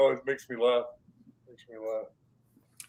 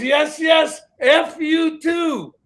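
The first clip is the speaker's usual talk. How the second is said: much higher, loud, drawn-out yell; mock shout; 12 semitones higher, 7 dB louder, much slower than usual. First guy yells back cheerfully in the retold clip. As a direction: holler it back slowly and gleefully. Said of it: "He goes".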